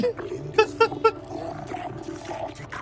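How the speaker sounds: silent breathless wheeze; airy high wheezing laughter; helpless wheezing laugh. Real, not acted.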